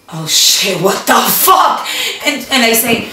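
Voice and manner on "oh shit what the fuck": slow shocked oh shit; caught-out gasp of alarm; shocked curse. Retold or imitated. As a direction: sorpresa alarmada. Shocked reaction in story.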